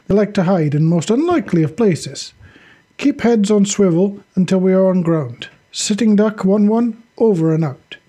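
soldier's cautioning radio voice; commander's radio warning. Acted. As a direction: warn the scouts over the radio. Voices Sasha replying by radio.

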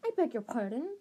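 lightly voiced prim girl, offended; a hint of a cold, proper schoolgirl; stiff Ravenclaw girl. A narrator's reading. Ravenclaw girl to strangers, lightly voiced and stiff. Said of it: "said the girl stiffly".

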